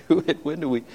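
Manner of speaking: a hungry guest muttering that this won't do; muttered complaint. Retold or imitated. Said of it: "and I'm saying".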